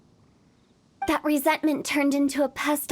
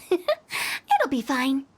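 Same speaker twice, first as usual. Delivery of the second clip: slow, airy reassurance with a laugh; 2 semitones lower, much slower than usual. Acted. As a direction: shrug it off with a chuckle. Laughs it off slowly.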